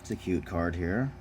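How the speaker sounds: normal talking voice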